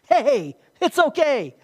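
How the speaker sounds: slow, loud and high, reassuring hard; emphatic reassurance. Acted. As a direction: reassure him firmly and drawn out, hey it's okay. Jesus reassuring Peter, voiced.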